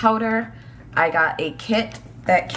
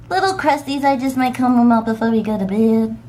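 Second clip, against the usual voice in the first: puppy voice; 5 semitones higher, 3 dB louder than usual. Acted. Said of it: "my puppy voice is annoying".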